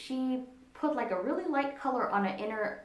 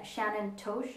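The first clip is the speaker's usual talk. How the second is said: normal talking voice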